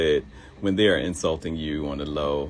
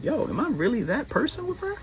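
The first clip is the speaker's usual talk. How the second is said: high self-doubting quote. Retold or imitated. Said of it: "I was like".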